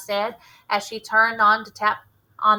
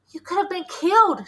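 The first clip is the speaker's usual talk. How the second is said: shrill panic; 9 semitones higher, 4 dB louder than usual. A narrator's reading. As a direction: voice jumps high with alarm. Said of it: "If her voice went any higher".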